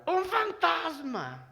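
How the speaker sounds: fearful cry of "un fantasma"; mock scared cry. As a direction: act out the disciples' panic, slowly.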